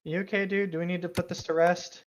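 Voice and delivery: ordinary delivery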